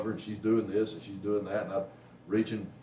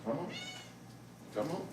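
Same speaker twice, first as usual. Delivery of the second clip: higher, coaxing come-on to the cat; coaxing call; 10 semitones higher, 11 dB quieter than usual. Real, not acted. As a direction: lure the cat with a lifted voice.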